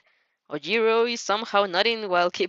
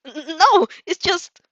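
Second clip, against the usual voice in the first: flustered stammer; 7 semitones higher, 6 dB louder, slower than usual. Acted. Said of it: "he shouts with a horrified whimper".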